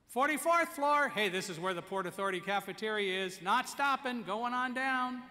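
loud, high call down the stairwell; calling out floors. Retold or imitated. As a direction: sing out the floor number for everyone to hear.

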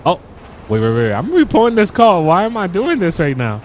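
sudden high, loud rush of alarm; alarmed excitement. Real, not acted. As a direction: catch yourself mid-drive and blurt it out. Spots a car mid-drive.

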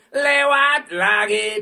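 loud, very slow stretched yell; drawn-out shout. Acted. Streamer bellowing at the machine, slow and loud. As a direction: bellow it out slowly. Chant repeated louder.

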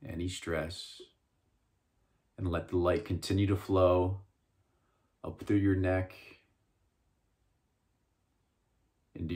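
slow, soft meditation voice with long pauses; guided meditation. Acted. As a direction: ease listeners into calm, phrase by unhurried phrase. Leads a guided visualization.

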